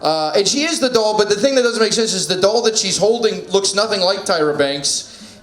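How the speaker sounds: ordinary delivery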